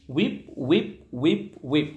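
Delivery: "Whip" for sweep, the s dropped; imitated child's mispronunciation. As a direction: say "whip" low and soft, losing the opening s. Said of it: "ও সুইপ বলবার চেষ্টা করছে". (শিশুর ভুল উচ্চারণ, "whip"-এ স বাদ)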